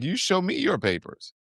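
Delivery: defiant retort. Retold or imitated. Uber driver throwing the agents' demand back, defiant imitation. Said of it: "He's like".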